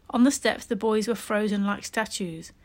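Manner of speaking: usual speaking voice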